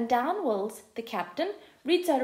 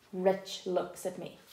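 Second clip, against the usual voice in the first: ordinary delivery, nothing marked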